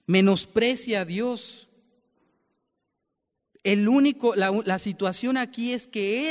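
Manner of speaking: high, slow, emphatic preaching; impassioned sermon build, loud and slow; fervent preaching. Acted. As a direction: press each phrase home like a pulpit climax. Preaching, rising fervor.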